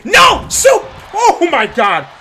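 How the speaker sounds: very high, loud stunned shriek; shocked scream. Real, not acted. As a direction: scream like you can't believe it. Shocked reaction to top-rope dive.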